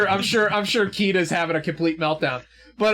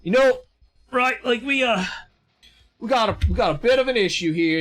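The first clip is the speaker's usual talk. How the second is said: higher-pitched, hesitant friendly welcome; welcoming big soldier; 5 semitones higher than usual. Acted. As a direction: genial officer stumbling through a greeting. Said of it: "he's like".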